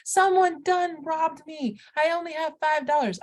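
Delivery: high, hammed-up Southern outrage; old-Western Southern accent. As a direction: wail it like a robbed townsperson in a Southern Western. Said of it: "a Southern, uh, like, a, an accent from, like, the old Westerns".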